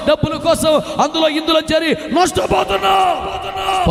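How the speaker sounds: rising, fervent preaching with shouted peaks. Acted. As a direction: build into a sermon cry. Rising sermon climax.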